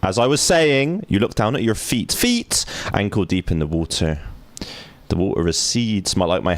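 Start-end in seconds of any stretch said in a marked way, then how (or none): 0.0-2.1 reclaim the floor; annoyed narrator. Acted. Mock-annoyed narrator resumes. 2.1-2.6 high squeal on feet; squeaky exclaim. Acted. Mocking narrator word.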